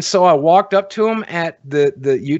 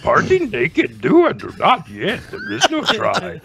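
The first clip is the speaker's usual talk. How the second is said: a lightly done Yoda voice, slow at the end; light Yoda impression, backwards phrasing; hint of Yoda at a YouTube meeting; mock Yoda; slower than usual. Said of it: "doing Yoda".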